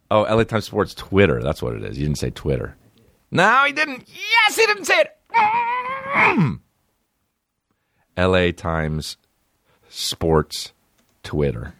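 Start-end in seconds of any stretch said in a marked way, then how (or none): 3.3-6.6 much higher falsetto squeal of joy; mock excited squeal. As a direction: shriek it like a giddy fan.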